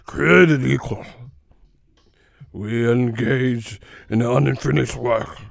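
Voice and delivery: loud, slow mock oratory, cracking up midway; exaggerated antique statesman imitation; old movie orator. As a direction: declaim it like an old-time speech in a film. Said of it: "have them sound like that in movies".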